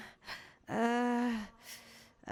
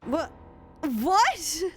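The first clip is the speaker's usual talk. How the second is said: shocked yelp; 8 semitones higher, 7 dB louder than usual. Acted. Shocked at insult.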